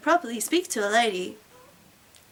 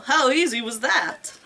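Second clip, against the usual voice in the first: normal talking voice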